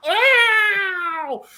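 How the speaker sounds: drawn-out high, loud "ow"; mock pain yowl. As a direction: yelp long like someone hit again. Imitates punched man's second cry.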